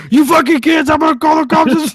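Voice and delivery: high, loud mock rant; angry old man. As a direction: yell at the prank-calling kids like a furious old man.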